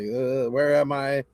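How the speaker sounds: slow, mildly dazed mumble; dazed and drooling. Retold or imitated. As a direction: play it half-asleep and lost, dragging the words. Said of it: "I'm sitting there drooling on myself".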